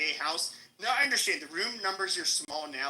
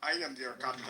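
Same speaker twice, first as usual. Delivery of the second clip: bad Somali accent; 6 semitones lower, 4 dB quieter than usual. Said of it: "I can't do a Somali accent".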